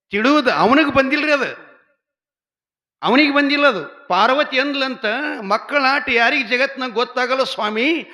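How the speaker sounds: high, loud fervent storytelling; excited telling. Real, not acted. Storyteller loudly recounting Parvati and Shiva. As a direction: tell the myth at full pitch.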